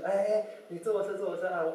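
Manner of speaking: overfriendly driver waving him into the car; eager invitation. Retold or imitated. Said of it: "看到我还很热心". (司机热情招呼上车)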